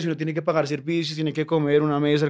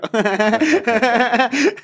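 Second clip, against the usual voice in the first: burst of laughter; 7 semitones higher, 7 dB louder than usual. Real, not acted. Laughing at own story.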